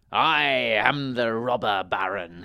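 loud, drawn-out villain's announcement; slow booming shout. A narrator's reading. Robber baron ambushes the wizard in the wood, slow shout. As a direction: announce yourself loudly, stretching the words. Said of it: "he shouted".

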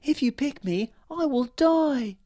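flower fairy warning the man she will die; pleading flower fairy. Acted. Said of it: "the flower fairy said".

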